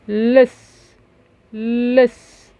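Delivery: لص slowly, holding the L and final s; sounded-out kasra. Shown as a demonstration. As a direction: stretch each sound of لص. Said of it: "لام ايه؟". (نطق لص مع مد أصواتها)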